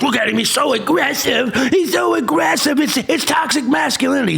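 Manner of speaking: high, prissy mock voice of a scandalized critic; mocking people who call Steve Gonsalves aggressive, high and prissy. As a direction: sneer it out in a high, fussy voice.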